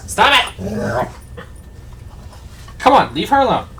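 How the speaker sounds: exasperated owner scolding; scolding the dog. Real, not acted. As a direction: snap at the dog to stop.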